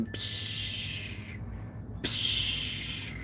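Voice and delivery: unvoiced Predator clicking; breathy alien clicks through the teeth. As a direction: imitate the Predator's clicking call. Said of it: "He's like".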